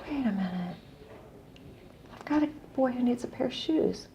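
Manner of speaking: soft, retold dawning thought; hushed realization. Telling the caller about a boy who needs shoes, hushed. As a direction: drop your voice as the idea lands.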